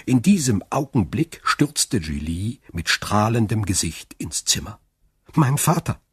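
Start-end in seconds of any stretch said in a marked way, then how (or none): 5.3-6.0 high, bright-eyed call to her father; excited call. A narrator's reading. Daughter bursts in calling her father, overjoyed. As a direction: call out to him, thrilled. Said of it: "rief sie".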